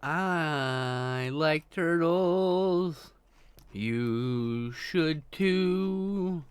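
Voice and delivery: stretched out, mock-earnest and slow; very slow drawl. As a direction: drag every word out sadly. Slow dramatic turtle quote.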